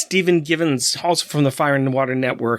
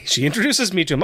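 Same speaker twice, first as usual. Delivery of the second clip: usual speaking voice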